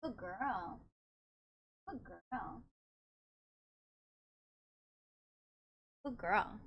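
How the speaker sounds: very soft murmured praise. Real, not acted. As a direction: gently praise the cat. Praising the cat softly.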